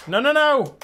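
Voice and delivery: alarmed no. Real, not acted. Drone battery dies mid-air.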